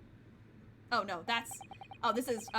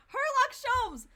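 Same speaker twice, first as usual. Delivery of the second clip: much higher, louder delighted call; excited cheer; 12 semitones higher, 8 dB louder, much slower than usual. Real, not acted. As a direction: cheer his name.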